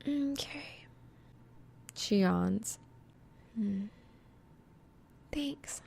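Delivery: soft high drowsy murmurs; fading into sleep, yawning; sleepy girl. A narrator's reading.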